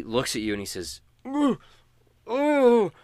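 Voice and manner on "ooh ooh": very high, loud whimpering ooh; a scared prisoner's high moan. Acted. Gagged prisoner moans at his rescuer, high and loud. GM voices Sly's frightened moan.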